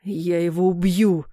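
slow, menacing rumble; rumbling threat. A narrator's reading. Father holding a pistol after the shot, rumbling threat. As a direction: draw the threat out with dark fury. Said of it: "пророкотал папа".

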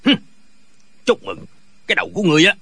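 understated low, grumbling anger; mild grouchy comeback in a deep voice; angry retort. A narrator's reading. Father snubbing the congratulations, mildly angry low retort. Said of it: "tức giận nói".

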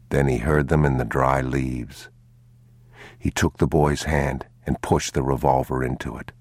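plain reading voice

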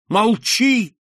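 high, loud frightened cry; horrified cry. A narrator's reading. Marquise silencing her daughter in horror. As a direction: cut her off in terror. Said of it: "повторила она".

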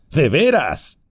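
bar their way with a sneer; burly bandit voice, taunting; menacing bandit. Acted. Bandit mocks Frodo.

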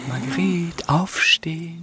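low, hushed whispered wake-up call. Acted. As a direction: gently whisper her awake. Whispers waking her.

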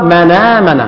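من آمن read as مَنَامَن with the hamza dropped; naql reading. Shown as a demonstration. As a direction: join من آمن into one flow, nun takes the fatha. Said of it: "تقرأ".

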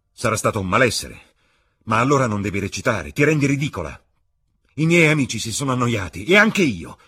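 loud, high, building to a cutting peak; disgusted lover berating the actress; contemptuous scolding. Acted. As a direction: scold her harder with each phrase. Dorian scolds her performance.

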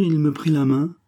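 ordinary delivery

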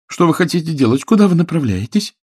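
высоко и громче, с тревогой; испуганно спросить на ходу; встревоженный возглас. Acted. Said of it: "вскричала Билли". (Билли встревожена, что Сэм везёт её, высоко)

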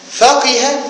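فقه announced high as "faqiha", with i after the qaf; middle vowel i. Shown as a demonstration. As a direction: call out فقه with a clear middle i. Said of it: "وكسر العين".

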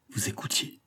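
ordinary delivery

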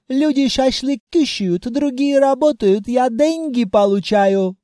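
put-on hammy bragging Caucasian accent. Djinn boasts in broken accent.

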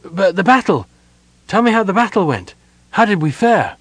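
high-pitched, urgent questioning; urgent demand. A narrator's reading. Sylvan begs the elf for battle news, urgently. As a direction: press anxiously for news.

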